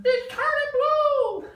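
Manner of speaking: very high, loud and slow shriek; frantic yell. Acted. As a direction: shriek it like a terrified grandmother. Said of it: "Grandma's yelling".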